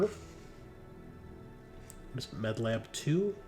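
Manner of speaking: ordinary talk, nothing marked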